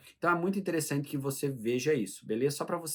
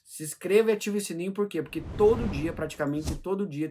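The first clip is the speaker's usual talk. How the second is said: clickbait video narrator; 5 semitones higher, 5 dB louder than usual. Acted. Subscribe call-to-action pitch.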